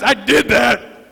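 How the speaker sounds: slow, proud, weight on DID; proud emphatic claim. Pretend. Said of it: "the guy who goes".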